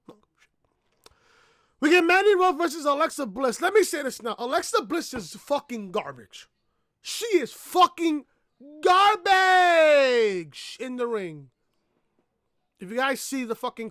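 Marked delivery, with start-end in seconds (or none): 0.1-1.1 whispered dismissive aside. Real, not acted. Vlogger brushing off SmackDown to viewers, whispered. 7.0-11.5 slow, stretched garbage; drawn-out insult. Real, not acted. Vlogger trashing a wrestler's in-ring work, drawn out.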